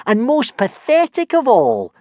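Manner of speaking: high, slow, sneering bully voice; jeering. Acted. Snotlout mocking Hiccup in a slow, high jeer. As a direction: drag it out to humiliate him. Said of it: "jeered Snotlout".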